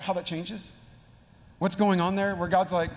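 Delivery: normal talking voice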